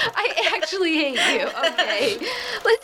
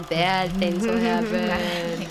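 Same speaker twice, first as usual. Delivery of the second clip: slow, low and ominous; 7 semitones lower, much slower than usual. Acted. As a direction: say it like a doom prophecy. Ominous line.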